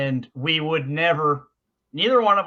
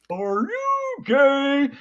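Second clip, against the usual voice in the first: high, slow, drawn-out silly voice; funny mock voice; 9 semitones higher, much slower than usual. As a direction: stretch it out like a class clown teasing the teacher. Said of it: "our friend in this kind of funny voice he did go".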